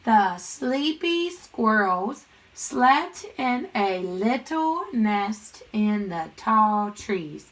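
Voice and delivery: very slow, word-by-word reading; stretched-out read-along for a class; read-along pace. Shown as a demonstration. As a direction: draw out each word so pupils can join in. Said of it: "Read it with me".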